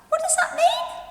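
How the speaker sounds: high squeaky puppet voice. Acted. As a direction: play the puppet asking, high up. Teacher voices a puppet asking.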